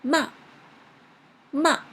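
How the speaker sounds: "Ma" dropped sharply from top to bottom; sharp falling tone. Shown as a demonstration. Teacher demonstrating fourth tone, sharp falling "Ma". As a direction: throw "Ma" straight down, short and sharp. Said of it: "It is a very sharp".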